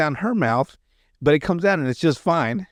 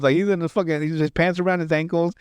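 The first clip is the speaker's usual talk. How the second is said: ordinary talk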